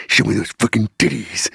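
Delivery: sneak up and murmur it low in the ear; sneaking-up come-on voice. Retold or imitated. Husband sneaking up behind wife's ear, low creepy voice. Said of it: "this exact voice, I go".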